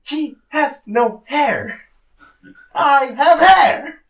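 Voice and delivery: slow, high, very loud protest; indignant shouting. Real, not acted. Co-host defending himself against teasing, indignant shouting. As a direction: hammer out each word at a yell. Protests being compared to Lars.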